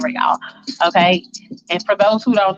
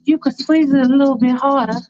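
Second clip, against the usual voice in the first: higher, daring tease; provocative girlfriend; 5 semitones higher than usual. Retold or imitated. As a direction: dare him to go rougher. Faith provoking.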